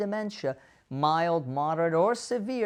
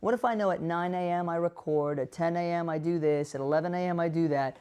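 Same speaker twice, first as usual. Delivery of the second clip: ordinary talk, nothing marked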